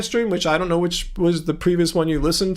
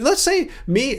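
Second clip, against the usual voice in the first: voice jumps high; 7 semitones higher, slower than usual. Real, not acted. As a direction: pitch jumps higher on "let's say me". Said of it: "as I did it right there".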